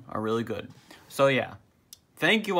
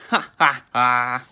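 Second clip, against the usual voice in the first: loud, acted ha-ha; mock laugh; 7 dB louder, slower than usual. A narrator's reading. Little fish mocking the haunted mattress costume, mock laugh. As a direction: laugh it out word by word, loudly.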